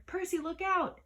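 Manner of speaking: high, loud cry of alarm; urgent warning cry. A narrator's reading. Annabeth warning Percy of the Fury's whip, loud alarmed cry. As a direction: scream the warning across the bus. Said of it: "Annabeth cried".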